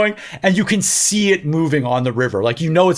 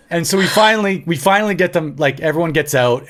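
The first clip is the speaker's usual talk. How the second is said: ordinary talk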